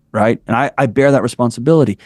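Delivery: normal talking voice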